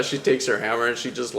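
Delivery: usual speaking voice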